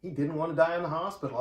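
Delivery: ordinary talk, nothing marked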